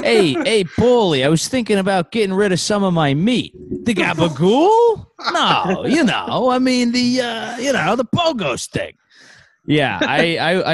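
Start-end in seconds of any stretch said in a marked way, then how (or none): 0.0-3.5 high-pitched Italian wiseguy; hammed-up Italian-American accent; mock Italian-American mobster. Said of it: "attempted an Italian accent". 3.9-5.0 high, stretched-out Italian wiseguy question; hammed-up Italian-American accent; mock Italian-American mobster. Mobster reply in the skit. 5.3-9.0 high, whiny Italian wiseguy; hammed-up Italian-American accent; mock Italian-American mobster. Mobster skit continues.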